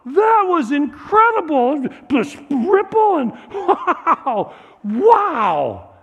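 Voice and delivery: high, loud, slow gasp of wonder; child's amazed whoa. Acted. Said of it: "He's like".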